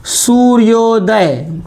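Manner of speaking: सूर्योदय stretched out slowly with a clear ओ; सूर्योदय, very slow, every syllable clear; slow careful pronunciation. Shown as a demonstration. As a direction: spell out सूर्योदय slowly so the ओ rings. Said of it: "इसका pronunciation यानी उच्चारण कैसा होगा".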